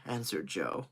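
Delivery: usual speaking voice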